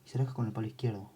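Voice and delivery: usual speaking voice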